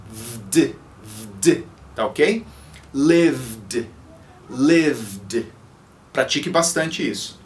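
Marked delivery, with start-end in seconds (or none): 0.1-1.6 "vd" said slowly as one voiced cluster. Shown as a demonstration. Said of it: "Esse som fica vd". 2.9-5.5 "Lived" going straight from v to d, no vowel; "Lived" drilled as one syllable. Shown as a demonstration. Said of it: "Esse som fica vd".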